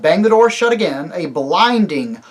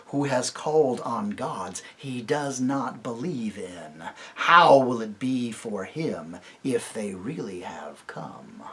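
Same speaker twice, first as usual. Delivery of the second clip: slow, soft whisper; hushed inner thought; 5 semitones lower, 6 dB quieter, slower than usual. Acted. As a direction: muse it to yourself, awed and uneasy. Eagle's private thought.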